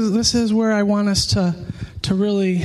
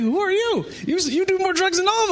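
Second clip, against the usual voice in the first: much higher, incredulous voice of his stoner friends; mock the shocked friends, pitched way up; astonished buddies; 10 semitones higher than usual. Friends at the bong asking him, pitched-up disbelief. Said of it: "They're like".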